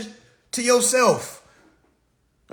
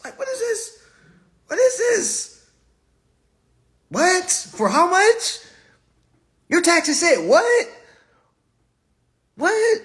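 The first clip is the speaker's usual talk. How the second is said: pitched way up, incredulous What?; mock disbelief; 8 semitones higher, 3 dB louder, slower than usual. Comic reacting to a tiny paycheck, pitched-up disbelief. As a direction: squeak each What in outrage.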